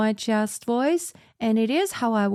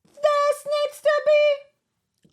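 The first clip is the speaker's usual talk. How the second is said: contrasting intensity levels; 11 semitones higher, slower than usual. Shown as a demonstration. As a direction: chant it high, then leap up into a belt. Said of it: "that is a different intensity level".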